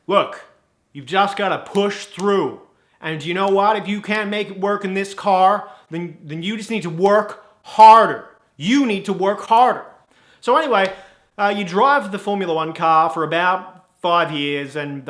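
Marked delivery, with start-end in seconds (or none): 0.1-9.8 mildly stern American manager; a bit stern. Acted. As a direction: press the point, building on 'harder'. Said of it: "he gets a bit stern".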